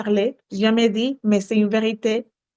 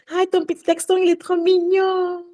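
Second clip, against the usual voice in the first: cooing compliment; 7 semitones higher than usual. Retold or imitated.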